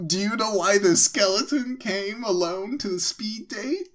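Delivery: chirpy pickup line delivery; high cheesy pitch. Pretend. Pitching a pun at speed date.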